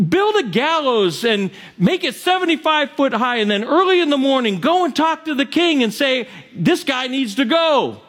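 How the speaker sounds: scheming wife. Acted. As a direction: egg him on, it's easy.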